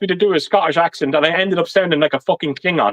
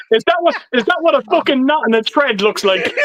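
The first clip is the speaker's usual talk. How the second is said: higher, through laughter; laughing tease; 6 semitones higher than usual. Real, not acted. Host ribbing a friend flexing his pecs, laughing tease. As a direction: tease him while cracking up. Teasing arm flex amid laughter.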